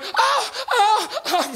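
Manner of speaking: short, very high mock moans; imitated high female cries. Woman in the video heard over the phone, mock moaning. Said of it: "the lady on the phone starts going".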